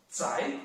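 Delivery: "Zai" climbing sharply upward; second tone. Shown as a demonstration. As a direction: raise "Zai" like a question. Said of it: "second tone".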